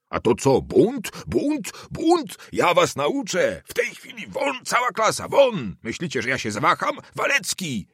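high-pitched tirade, climbing on each outburst; furious shouting. A narrator's reading. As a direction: scream at the whole class in fury. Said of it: "zaczął krzyczeć, bijąc w nie pięściami". (wściekły, wysoki krzyk)

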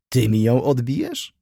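teasing, with a smile in the voice; smiling question. A narrator's reading. Wokulski teasing his old friend Rzecki, smiling. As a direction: toss it back with a grin. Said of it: "uśmiechnął się Wokulski".

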